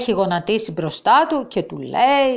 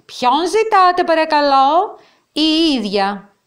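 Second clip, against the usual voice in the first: higher, lightly voiced young girl; little girl voice; 7 semitones higher, 5 dB louder than usual. A narrator's reading. Marina answers a caller, a light girlish voice. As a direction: play the eager daughter on the phone.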